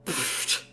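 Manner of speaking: a dismissive breathy pfft; scoffing huff. A narrator's reading. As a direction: blow out a scornful pfft. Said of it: "Reggie huffed".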